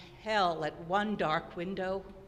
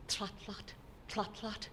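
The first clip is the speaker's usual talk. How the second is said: soft hoofbeats; 8 dB quieter than usual. Shown as a demonstration. Hoofbeats drawing nearer.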